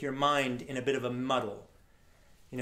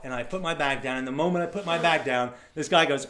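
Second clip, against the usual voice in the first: ordinary delivery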